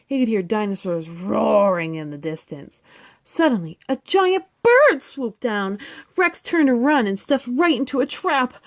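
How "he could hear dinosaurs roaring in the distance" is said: plain reading voice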